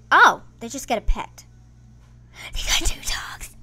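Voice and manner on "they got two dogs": breathless unvoiced whisper; awed whisper. Real, not acted. Player whispers in awe at game dogs. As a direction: whisper it in disbelief.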